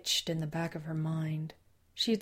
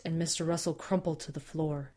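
ordinary delivery, nothing marked

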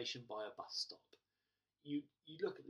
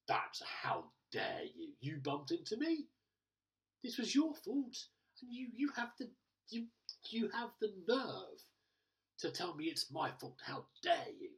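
acted indignation; 7 semitones higher, 6 dB louder than usual. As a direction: play the wronged party, building to a high protest. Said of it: "this sense of grievance".

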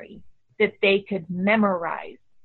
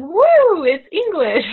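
high, loud whoop of amazement; delighted surprise; 10 semitones higher, 9 dB louder, slower than usual. Retold or imitated. Mother seeing her daughter's improved notebook, whooping high and loud. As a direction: cheer at a sudden breakthrough. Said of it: "and you're going".

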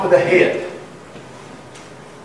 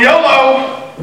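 mocking yolo; 5 semitones higher, 3 dB louder than usual. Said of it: "always walking around going".

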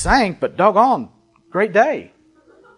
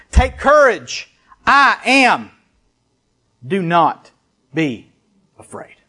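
solemn proclamation; slower than usual. Acted. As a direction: proclaim it slowly, pausing, then let it sink away. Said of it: "let me read it that way".

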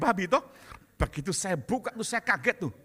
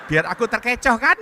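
wife laughing; 3 semitones higher, 11 dB louder than usual. Retold or imitated. Wife's punchline in joke.

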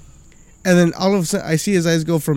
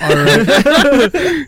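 laughing; 7 semitones higher, 7 dB louder than usual. Real, not acted. Laughing relief.

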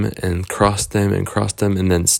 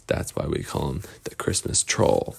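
hushed warning; 5 semitones lower, 7 dB quieter than usual. Acted. Lost Boys warn about troll.

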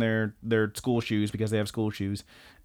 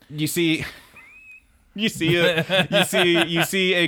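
higher, restarting through laughter; laughing; 5 semitones higher, slower than usual. Real, not acted. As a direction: try to narrate while cracking up. Cracking up at panty shot.

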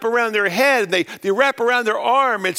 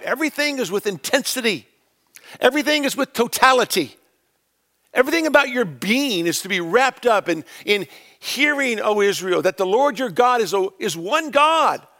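preaching at full force; 4 semitones higher than usual. Acted.

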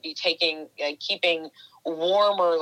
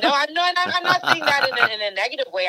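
laughing; 10 semitones higher, 4 dB louder, faster than usual. Real, not acted. Laughing protest at teasing.

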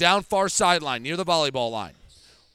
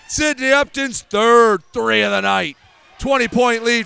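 excited call; 4 semitones higher, 6 dB louder than usual. Real, not acted.

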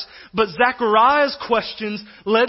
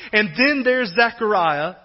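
ordinary delivery

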